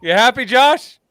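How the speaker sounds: high teasing taunt. Real, not acted. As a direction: needle Josh playfully. Teasing absent commenter Josh.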